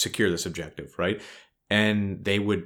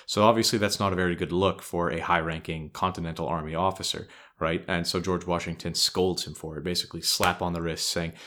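normal talking voice

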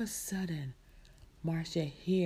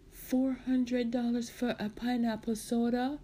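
higher-pitched disbelief, rising at the end; incredulous question; 5 semitones higher than usual. Acted. Marcia questioning the seller's price, incredulous. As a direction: balk at the price, voice lifting.

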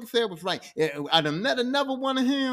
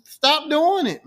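loud, higher, drawn-out plea; exasperated scolding; 5 semitones higher, 8 dB louder, much slower than usual. Retold or imitated. He Who Remains begs Loki to quit, exasperated scolding. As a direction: stretch it out in mock exasperation.

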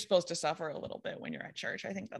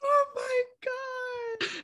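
slow, high, breathless with laughter; stretched out in disbelief; laughing; much slower than usual. Real, not acted. Slow and high with laughter at the rude punchline.

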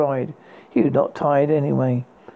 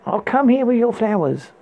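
high called-out line to a window; prince calling up; 6 semitones higher than usual. Acted. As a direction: call up brightly to the tower. Prince calls up to tower.

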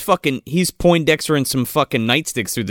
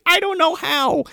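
high helpless whine; mock helpless hero. Hero admitting he can't load the gun, high and helpless. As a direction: whimper it out. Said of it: "He's like".

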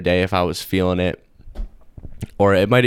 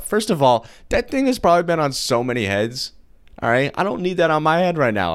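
indignant rant; 7 semitones higher than usual. Real, not acted. Indignant rant about lice.

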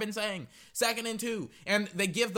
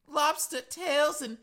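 put-on Boston accent; 8 semitones higher, slower than usual. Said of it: "that Boston accent".

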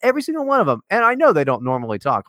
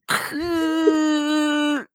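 high, strained, laughing mock DJ voice; imitating a botched radio announcer, way up high; mock nerdy radio voice; 12 semitones higher, much slower than usual. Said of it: "instead you were like".

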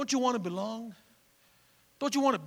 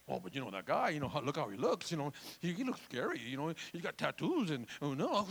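low, softer voice of a hesitant gossip; gossiping churchgoer; 8 semitones lower, 6 dB quieter than usual. Acted. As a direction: confide it low, as if tattling. Voices a gossip about a brother.

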